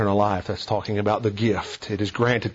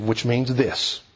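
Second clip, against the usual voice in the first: ordinary talk, nothing marked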